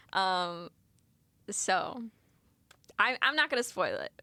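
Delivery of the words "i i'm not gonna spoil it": ordinary delivery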